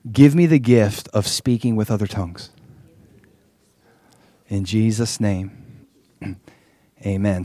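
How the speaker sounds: leading prayer. Real, not acted. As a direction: lead a repeat-after-me prayer, phrase by phrase. Leads a second prayer.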